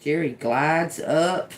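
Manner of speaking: usual speaking voice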